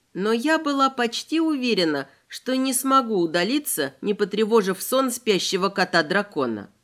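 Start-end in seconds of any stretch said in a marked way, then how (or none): none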